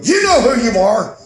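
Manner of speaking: high, loud starstruck fan voice; gushing fan. Retold or imitated. Fan rushing up to [name], high and loud. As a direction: play an overexcited fan rushing up. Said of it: "They'd run up in his face and go".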